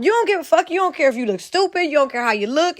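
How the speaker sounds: ordinary talk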